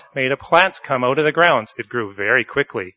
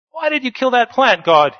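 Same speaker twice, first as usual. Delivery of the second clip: much higher, loud cry of protest; yelled; 12 semitones higher, 6 dB louder than usual. Acted. Jonah yells at God about the dead plant in a high cry. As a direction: shriek it at God like a wronged child. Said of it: "yelled Jonah".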